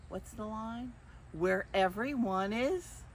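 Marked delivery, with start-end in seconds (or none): none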